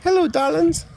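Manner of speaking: high, rising, camp Hello darlings; sing-song diva greeting, way up high; campy drag queen. Acted.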